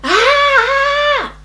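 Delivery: long, high scream; drawn-out "aaah" of agony; demonstrated labor scream. Retold or imitated. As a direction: wail out in acted pain. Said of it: "That is the way I was screaming".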